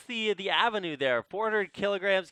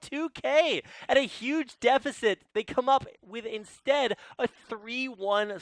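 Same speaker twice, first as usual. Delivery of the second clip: louder, higher caster hype; excited play-by-play; 8 semitones higher, 6 dB louder than usual. Real, not acted. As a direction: build to the clutch. Final duel called live.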